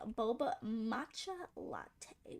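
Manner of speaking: reading it out slowly and softly; slow deliberate readout; slow, careful. Real, not acted. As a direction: take it slow, word by word. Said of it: "don't you rush me".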